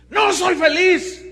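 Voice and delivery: high, loud, slow declaration; dramatic confession. Retold or imitated. Preacher voicing Slim admitting unhappiness, loud and slow. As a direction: proclaim it loudly and slowly.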